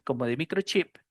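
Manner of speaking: normal talking voice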